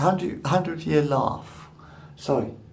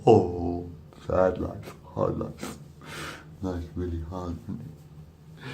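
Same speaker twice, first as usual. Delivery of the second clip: mock sad lament; 8 semitones lower, 7 dB quieter, slower than usual. Real, not acted. Contrasts fake happiness with sadness.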